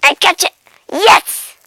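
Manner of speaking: loud, high victory shout; triumphant. Acted. Cat finally getting the butterfly, triumphant. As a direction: cry out in triumph.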